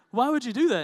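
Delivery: high, loud mock protest; exasperated outcry. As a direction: blurt it out in hammed-up disbelief. Repeats the retold protest louder.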